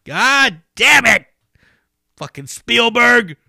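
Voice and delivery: high, loud exasperated shout; angry rant. Real, not acted. Fan yelling at Spielberg over the wrong guitar, furious shout. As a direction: blast the director for the mistake.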